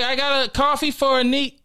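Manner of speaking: high-pitched nervous barista impression. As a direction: imitate her faltering before the name.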